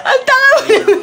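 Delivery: high teasing laugh; laughing tease. Real, not acted. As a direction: tease while laughing hard. Mocking through laughter.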